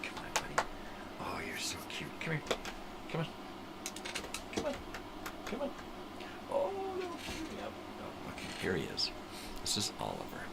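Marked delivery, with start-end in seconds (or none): 0.0-2.1 soft unvoiced whisper; whisper to the dog. Real, not acted. Whispering sweetly to the dog Oliver as he comes over. 2.2-7.6 very high squeaky coo. Real, not acted. Cooing at the dog Oliver in a very high squeaky voice.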